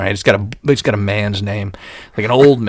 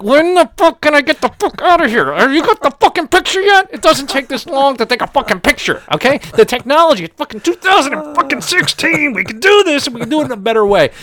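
much higher, lips barely moving; ventriloquist voice; 4 dB louder than usual. Retold or imitated. Calling out to the technician from inside the tube, ventriloquist talk. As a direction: squeeze it out like a ventriloquist. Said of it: "trying to talk like a ventriloquist".

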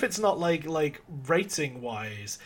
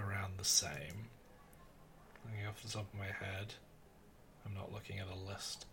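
whispering; 6 semitones lower, 15 dB quieter than usual. Real, not acted. Very quiet unvoiced lines.

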